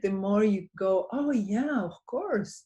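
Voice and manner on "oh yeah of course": higher-pitched mock realization; pleased recognition. Practitioner recalling the day's good moments, pleased recognition. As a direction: act out a sudden happy recollection.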